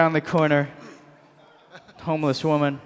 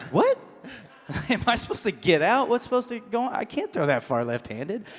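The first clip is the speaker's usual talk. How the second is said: higher, baffled mock questioning; confused; 6 semitones higher, 5 dB quieter than usual. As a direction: act out bewildered self-talk, pitch climbing. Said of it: "I was, like, confused".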